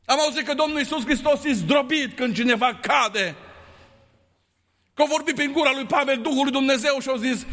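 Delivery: ordinary delivery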